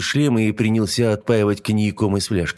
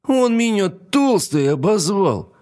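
higher, slow tearful complaint; near tears; 11 semitones higher, 3 dB louder, slower than usual. A narrator's reading. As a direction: whine it close to crying. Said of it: "чуть не расплакалась".

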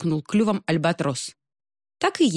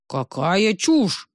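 slow outraged king; indignant; 2 semitones higher, much slower than usual. Acted. Морской царь возмущается советом альбатроса, медленно и возмущённо. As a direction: drag it out in outrage. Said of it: "возмутился морской царь".

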